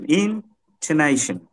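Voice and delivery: Intonation drawn out slowly in waves; slow undulating intonation; wavy intonation. Shown as a demonstration. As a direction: stretch intonation and let it fall at the end. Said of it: "কণ্ঠের ঢেউ, এটা হচ্ছে intonation".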